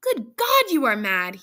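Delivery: loud, high cry falling in pitch; appalled, loud outburst; horrified cry. A narrator's reading. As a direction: exclaim it in horror, hitting the start high. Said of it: "struck with horror".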